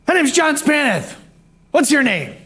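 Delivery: over-the-top cheery, high-pitched voice; John Spanath. Retold or imitated. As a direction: chirp it like a relentlessly cheery movie character. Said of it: "he was that cheery, like a movie almost".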